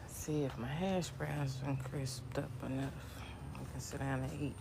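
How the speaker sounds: breathy unvoiced murmur to herself; whispered aside. Real, not acted. Vlogger whispering to herself at the stove, quietly. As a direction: hush it like a private thought.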